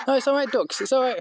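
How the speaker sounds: calm the ducks like pets; sweet reassurance to the ducks; soothing pet talk. Real, not acted.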